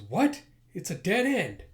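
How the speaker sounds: high, loud disbelief; indignant surprise. Acted. Leader finding a dead end, indignant outburst. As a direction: exclaim in outraged disbelief.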